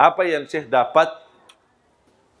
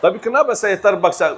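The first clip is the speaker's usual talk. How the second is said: usual speaking voice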